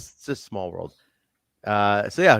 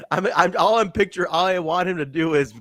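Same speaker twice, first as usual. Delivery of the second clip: usual speaking voice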